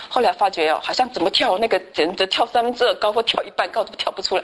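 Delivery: ordinary talk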